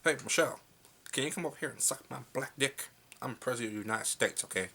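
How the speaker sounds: a hammed-up presidential address; Obama impression. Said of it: "a voice of Obama?".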